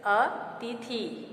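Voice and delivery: अतिथि very slowly as "a... tee-thee"; mispronunciation. Shown as a demonstration. Teacher repeating the word for students, slow long-vowel mispronunciation. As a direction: stretch अतिथि into long ee sounds. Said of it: "अशुद्ध है".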